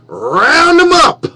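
slow, high, loud rallying yell; drawn-out shout. Shown as a demonstration. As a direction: bellow it out long and slow. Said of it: "I ain't saying it like a cowboy".

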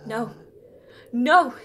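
loud desperate no; desperate cry. Acted. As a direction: cry out in panic. Pleading with lord.

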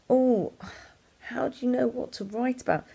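high, puzzled questioning voice; curious asker. Retold or imitated. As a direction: ask it like a stumped child. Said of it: "people often ask me".

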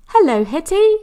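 cheery neighbour. Acted. Mrs Milton greeting Hetty at the washing line, high and loud.

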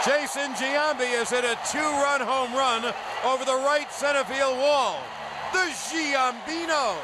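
rising play-by-play to a shout; home run call. Acted. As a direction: build the call to a roaring finish.

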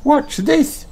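very high rabbit squeak; Rebecca's squeak. A narrator's reading. Rebecca shows off her jump, squeaking. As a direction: squeak it like a little bunny. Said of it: "squeaks Rebecca".

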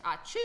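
loud fake sneeze; sneeze sound. Shown as a demonstration. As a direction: act out a sneeze. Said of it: "That's me when I sneeze".

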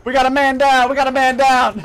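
loud urgent callout; man down shout. Real, not acted. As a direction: shout it to the squad. Callout of downed teammate.

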